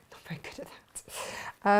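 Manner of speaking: whispered under her breath; half-laughing whisper to herself. Real, not acted. Sewer admits she can't talk and sew, in a whisper.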